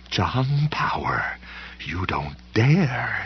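slow, whispered snarl. A narrator's reading. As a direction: snarl the name through the threat. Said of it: "she'd snarl".

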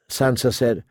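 ordinary delivery

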